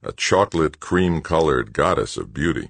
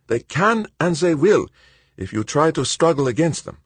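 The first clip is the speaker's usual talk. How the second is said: put-on higher, quicker voice for the anxious old friend; Verban, worried old colleague; 13 semitones higher than usual. A narrator's reading. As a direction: voice Verban as tense and urgent. Verban's voice in the read story.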